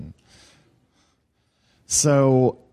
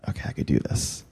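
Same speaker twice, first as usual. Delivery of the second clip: nervous whisper; 7 semitones lower, 8 dB quieter than usual. Retold or imitated. Nervous ten-year-old to himself.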